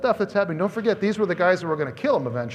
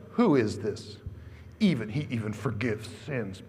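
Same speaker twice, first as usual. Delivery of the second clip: scoffing Pharisees; 8 semitones lower, 6 dB quieter than usual. Retold or imitated. Pharisees muttering in disbelief.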